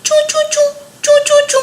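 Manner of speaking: very high tiny chirping; baby bird chirp. Acted. Storyteller chirps the lost chick's cry for children. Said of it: "टीना को आवाज आई".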